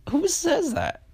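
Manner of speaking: squeaky high "Who says that?" with "Who" hit hardest; mock disbelief. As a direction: squeal the question in comic outrage. Incredulous repeat of her message.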